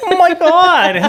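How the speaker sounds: slow, very high falsetto with a laugh; mocking exaggerated imitation. Boyfriend complains she makes him sound silly, falsetto mock squeal. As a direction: send up her over-the-top impression of you. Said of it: "you make me sound like".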